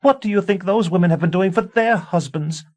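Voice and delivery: high, loud screaming tirade; screaming wife. A narrator's reading. A wife screaming warnings at her husband. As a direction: shriek the warning at him.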